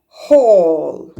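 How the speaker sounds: "hall" whispered with a long open aw; whispered demo word. Shown as a demonstration. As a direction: breathe out "hall" with no voice. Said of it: "Letter A makes O sound".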